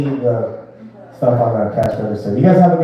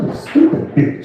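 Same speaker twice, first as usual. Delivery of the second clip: laughing mock insult; 14 semitones higher, slower than usual. Slipping in new insult, laughing.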